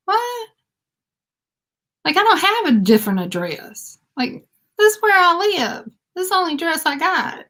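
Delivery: acting out her shock again; retold disbelief. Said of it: "I just couldn't believe".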